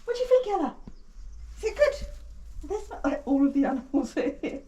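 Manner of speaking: high cooing pet talk. Real, not acted. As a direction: coo at the pigs. Talking to the pigs.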